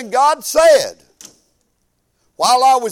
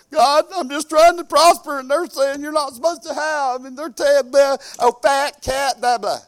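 retold crying prayer; 6 semitones higher than usual. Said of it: "'cause I was crying".

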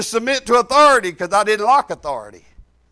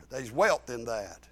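ordinary talk, nothing marked

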